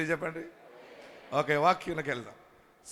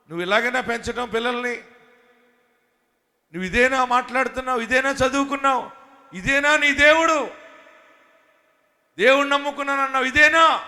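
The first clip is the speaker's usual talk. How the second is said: higher, fast, scornful jeering; mock like neighbours mocking your faith; imitated scornful accusers, building louder; accusing taunt; 7 semitones higher, 6 dB louder than usual.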